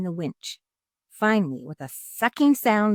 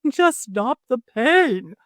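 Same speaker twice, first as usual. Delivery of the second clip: slow, louder whimpering plea; 6 semitones higher, 7 dB louder, slower than usual. A narrator's reading. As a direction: whimper through the tears. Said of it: "whimpered the fox".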